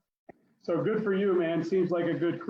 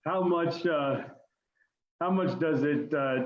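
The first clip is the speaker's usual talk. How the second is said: ordinary talk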